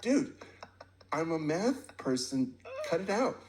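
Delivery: half-laughing mock outburst; exasperated protest. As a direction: throw up your hands laughing. Retelling refusing [name]'s invitation.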